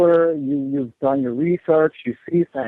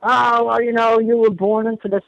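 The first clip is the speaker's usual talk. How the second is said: higher, sing-song mockery; imitating pro-gray believers, mocking and higher; 6 semitones higher than usual. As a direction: mimic them in a higher, dismissive voice. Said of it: "there's a lot of people who are pro-gray".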